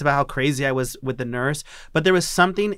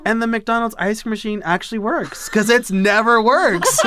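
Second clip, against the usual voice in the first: laughing; 8 semitones higher, 5 dB louder than usual. Retold or imitated. Laughing at her ice cream story.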